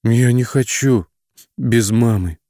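put-on slow, high, wounded child's whimper; hurt child's whimper. A narrator's reading. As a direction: whimper like a hurt little girl. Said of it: "обиженно пролепетала малышка".

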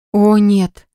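ordinary delivery

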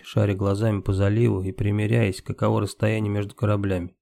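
usual speaking voice

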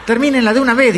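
high, loud fed-up shout; exasperated moviegoer shouting; acted yell. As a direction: yell at them to end it. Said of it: "empezó a grito pelado".

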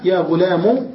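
"غلام" said "ghulamu", short u; damma without ya. Shown as a demonstration. Teacher repeating the three vowels, short u ending. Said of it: "بالحركات الثلاثة بدون ياء".

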